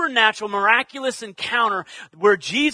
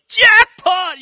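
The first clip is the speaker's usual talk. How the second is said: high, loud shout of triumph; explosive mock celebration; jubilant winner's cry; 10 semitones higher, 11 dB louder than usual. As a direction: yell it like a slot-machine win. Acting out a slot machine win.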